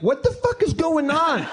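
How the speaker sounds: high, drawn-out cry of bafflement; exasperated outcry. Retold or imitated. Baffled customer to the store clerk, exasperated outcry. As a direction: stretch it out high in mock exasperation.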